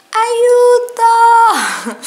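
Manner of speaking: high, loud playful cry for help; mock cry for help. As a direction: yelp for help, half joking. Overwhelmed after the shopping haul.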